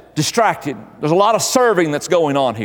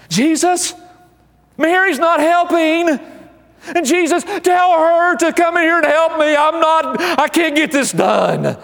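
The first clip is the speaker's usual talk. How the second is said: high, fretful complaining housewife voice; Martha's attitude; 9 semitones higher than usual. Acted. Preacher voicing Martha complaining to Jesus, high and fretful. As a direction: whine it up high like an overworked hostess. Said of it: "That's a little of her attitude there".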